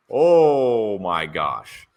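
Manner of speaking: stretched-out oh my gosh; slow dramatic gasp. Acted. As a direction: drag it out in mock shock. Reaction to gossip.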